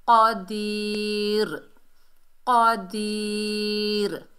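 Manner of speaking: क़दीर very slow, long i, closed r; stopping form. Shown as a demonstration. Teacher shows the pause, drawn-out क़दीर. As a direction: stretch क़दीर and stop on r. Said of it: "इस पर हम वक्फ करेंगे".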